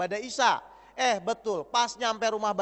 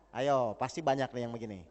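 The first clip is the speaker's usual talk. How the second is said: normal talking voice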